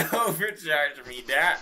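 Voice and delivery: slow, giggling mock plea; teasing through laughter; laughing. Real, not acted. Laughing repeat of the joke.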